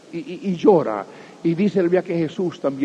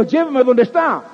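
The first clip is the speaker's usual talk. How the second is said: louder and higher, commanding; firm voice of Jesus; 8 semitones higher, 5 dB louder than usual. Acted. Jesus insisting to Martha, raised commanding voice. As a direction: insist on going to the tomb.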